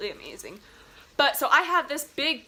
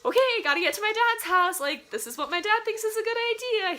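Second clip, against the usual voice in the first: mock cheery self; 11 semitones higher, 3 dB louder, faster than usual. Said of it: "was like".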